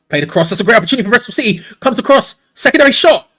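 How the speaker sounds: fast, loud and rising as the chance builds; excited commentary call. Real, not acted. Live commentator on a Bristol City chance, excited and loud. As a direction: call it like the ball is about to go in.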